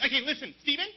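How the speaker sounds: high-pitched, drawn-out scolding; preachy activist girl impression; militant environmentalist girl. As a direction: lecture him in a mock girl's voice, climbing high. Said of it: "This one girl actually came up to me".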